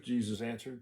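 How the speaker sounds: ordinary delivery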